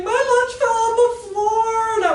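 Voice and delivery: slow, loud, high mock wail; wailing kids. Kids upset over kicked lunches, loud drawn-out wail. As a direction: mimic small children crying out in distress.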